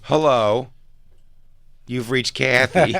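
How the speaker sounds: slow, drawn-out voicemail greeting; mock answering machine. Friend mimics the mom's answering machine slowly. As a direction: stretch it out like a stiff recorded greeting.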